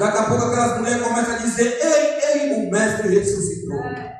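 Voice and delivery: fired-up preacher retelling a scene; impassioned preaching. Acted. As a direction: preach the story with urgency. Preaches at full force.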